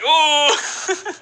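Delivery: high, drawn-out "ooh"; mock outraged "ooh". Player seeing the rival's new title, high mock "ooh". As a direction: let out a long high hoot.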